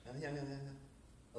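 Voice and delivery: soft, slow mumbled yeahs; a half-audible imitation; mock mumble. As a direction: mumble them too quietly to catch. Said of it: "she talks like this".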